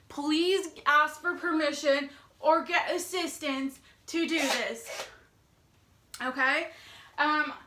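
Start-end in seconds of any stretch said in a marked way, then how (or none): none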